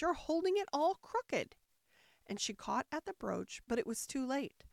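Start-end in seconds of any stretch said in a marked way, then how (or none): 0.0-1.6 high-pitched warning; crying out. A narrator's reading. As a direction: exclaim in alarm. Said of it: "cried Alice".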